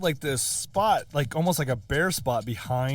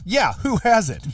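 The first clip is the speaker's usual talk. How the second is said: dry sarcastic comeback; 6 semitones higher, 4 dB louder, slower than usual. Retold or imitated. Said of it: "He's like".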